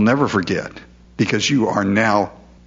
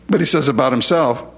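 ordinary talk, nothing marked

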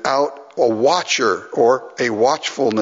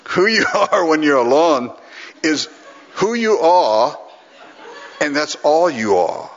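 hammed-up Scottish accent, higher and emphatic; [name]; 3 semitones higher than usual. Practising a colleague's line on the crowd, Scottish accent. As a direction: imitate a Scottish preacher's measured pronouncement. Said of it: "gonna get it down pretty quick".

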